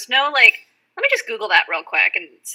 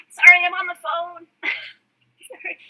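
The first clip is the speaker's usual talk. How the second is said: aside on the phone; 9 semitones higher than usual. Real, not acted.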